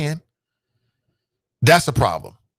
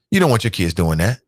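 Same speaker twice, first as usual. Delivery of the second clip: ordinary talk, nothing marked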